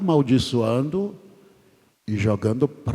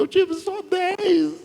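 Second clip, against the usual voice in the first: much higher, slow squeaked complaint; mock squeaky lament; slower than usual. Losing candidate admitting ten votes, slow, mock squeaky and high. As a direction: squeak it out like a deflated loser.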